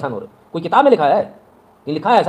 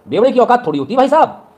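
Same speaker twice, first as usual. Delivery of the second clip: scornful shout; 10 semitones higher, 8 dB louder than usual. Real, not acted. Preacher scolding drunk husbands in the audience, loud scornful shout.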